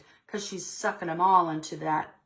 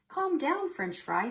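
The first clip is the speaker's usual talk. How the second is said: sing-song higher granny voice; higher gentle-granny calming voice; grandma voice; 9 semitones higher than usual. Acted. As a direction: soothe her like a fond grandmother. Grandma voiced in picture book.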